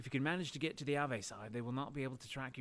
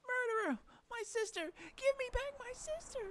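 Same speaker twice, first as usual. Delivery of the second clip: very high, shrill demand; tearful scream. Acted. Grieving girl screams at Faye again, tearful shout. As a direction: scream for her sister back.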